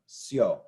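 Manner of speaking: "sio" as one syllable, syo, low; blended vowels. Shown as a demonstration. Teacher puts I and O together, "sio" as one sound. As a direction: glide the I straight into O in "sio". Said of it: "put the I and the O together to make one sound".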